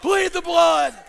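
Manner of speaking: very loud, high, drawn-out pulpit cry; climactic preaching shout. Real, not acted. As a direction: hammer out each word at full volume. Climax of the sermon.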